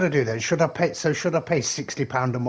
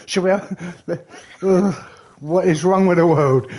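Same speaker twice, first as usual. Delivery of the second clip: ordinary talk, nothing marked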